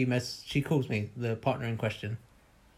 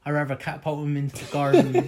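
laughing through the joke, a touch louder; 6 dB louder than usual. Real, not acted. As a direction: chuckle the punchline out. Joking about the clingy kid.

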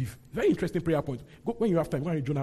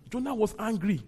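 ordinary talk, nothing marked

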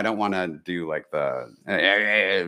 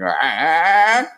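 confused grunt; 8 semitones higher, 5 dB louder than usual. Retold or imitated. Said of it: "a sort of a confused".

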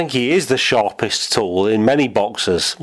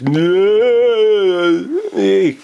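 catchphrase shout; 9 semitones higher, 5 dB louder than usual. Retold or imitated. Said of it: "your character's main catchphrase is".

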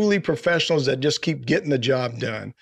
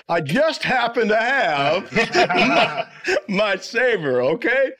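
ordinary delivery

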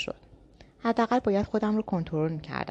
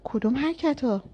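choked, slow, higher and hoarse; 7 semitones higher than usual. A narrator's reading. Girl in a chokehold coughs out a hoarse question. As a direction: force the words out through a squeezed throat. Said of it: "با صدای گرفته گفتم".

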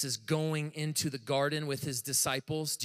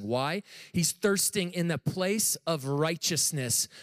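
ordinary talk, nothing marked

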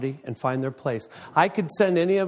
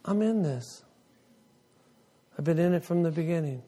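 voice of the Lord; 4 semitones higher than usual. Retold or imitated. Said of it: "the Lord's going".

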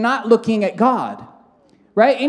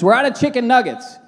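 mock calling out; 3 semitones higher than usual. Brother announces a shortage to the staff, mock calling out. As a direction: call it out as part of a prank.

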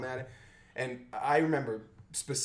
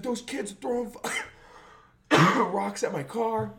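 higher, louder complaint; mock angry accuser; 11 semitones higher, 8 dB louder than usual. Driver complaining in the hallway, mock angry accusation.

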